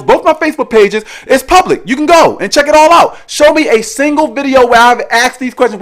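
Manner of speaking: high-pitched, heated demand for proof; emphatic challenge. Real, not acted. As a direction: challenge them to prove it, pitch pushed up. Challenging the accuser to show proof.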